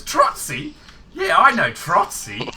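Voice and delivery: high, loud mock Aussie caller greeting a mate's name; imitating the caller. Caller on the phone recognising an old mate, high and loud. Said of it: "And he goes".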